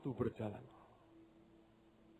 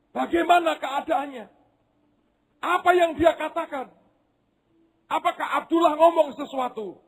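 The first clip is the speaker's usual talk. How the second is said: high, urgent family questions; anxious family asking at once; eager questions. Acted. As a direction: press her for news.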